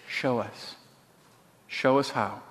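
low, soft, simple plea; matter-of-fact prayer. A narrator's reading. Betsy prays in the barracks, matter-of-factly. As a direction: pray it quietly, no show. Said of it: "It was so matter-of-factly".

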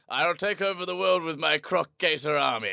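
high-pitched comic villain boast. Acted. Co-host imagining a villain with a gator army, mock villain voice. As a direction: declaim like a cartoon supervillain. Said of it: "I wanna know that villain".

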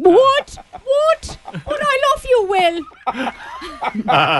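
high-pitched mock tearful wail; hammed-up weepy plea, laugh creeping in; Minnie Driver crying. Co-host mimics tearful movie love scene, mock crying. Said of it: "cue Minnie Driver crying".

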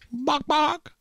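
high clucking hen bok bok; chicken impression. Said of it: "that's my chicken impression".

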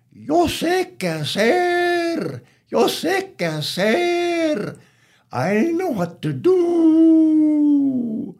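high, drawn-out crowing on the words; rooster crowing. Acted. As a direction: crow it like a rooster, stretching the last word. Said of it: "He started crowing".

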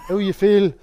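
high, loud leprechaun-like Belfast "How you feel?"; mock Belfast accent. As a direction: ham up a Belfast relative putting on the accent. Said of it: "sound like a leprechaun".

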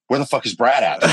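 playfully hammed-up search for him; imitating Emily. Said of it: "She was like".

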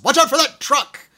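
sudden loud, high yell; yelled warning. Retold or imitated. Movie character startling his driver, full yell. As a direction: holler it like an urgent alarm to the driver. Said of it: "And he just yells".

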